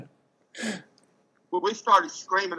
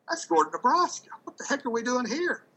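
higher-pitched mock alarm; retold startled disbelief; 8 semitones higher than usual. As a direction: replay a startled double-take, pitch up. Sudden alarm at seeing the town.

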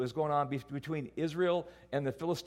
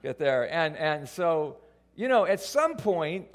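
ordinary talk, nothing marked

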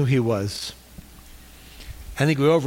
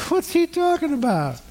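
high-pitched mock bewilderment; puzzled listener; 11 semitones higher than usual. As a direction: imitate a baffled listener in a much higher voice. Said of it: "some of you are like".

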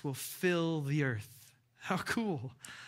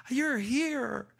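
put-on excited child greeting; 8 semitones higher, 4 dB louder than usual. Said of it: "and they're like".

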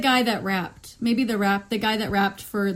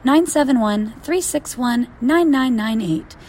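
animated, emphatic announcer delivery; lively scripted hotline plug; podcast promo read; 2 semitones higher, 3 dB louder than usual. Acted. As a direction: pitch the hotline to listeners with punch.

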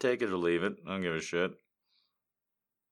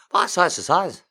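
half-shouted mock mumble; louder garbled repeat; 10 semitones higher, 10 dB louder, slower than usual.

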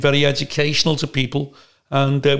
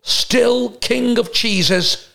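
much higher, very slow savouring voice; Brian Badondi; 6 semitones higher, slower than usual. Brian Badondi tasting the expensive mozzarella, higher and slow. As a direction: savour every word like a pompous connoisseur.

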